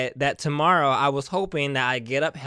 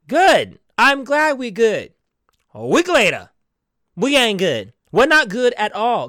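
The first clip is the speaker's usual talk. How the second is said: louder and higher, mock cheery; loud sarcastic good; 4 semitones higher, 7 dB louder than usual. Real, not acted. Telling the audience about the friend's broken promise, loud and sarcastic. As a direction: belt it out, then flip it sour.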